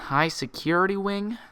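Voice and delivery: suspicious. A narrator's reading. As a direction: narrow in on the words. Twilight suspicious repeat.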